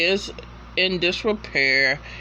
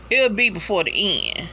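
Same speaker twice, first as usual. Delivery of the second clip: ordinary delivery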